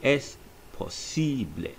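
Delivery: Es posible said slowly, Spanish vowels; Spanish phrase. Shown as a demonstration. Teacher models Es posible for students. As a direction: ess po-SEE-bleh, carefully. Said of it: "it's pronounced".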